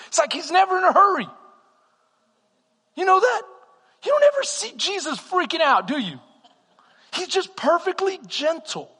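high, loud, fast preaching; preacher rising to a climax about Jesus; preaching at full force. Acted. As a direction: build the fire of the sermon.

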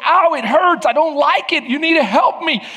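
a hint of a wounded cry; preacher acting out a hurt spouse; mock hurt outburst. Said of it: "you lash out because you're saying".

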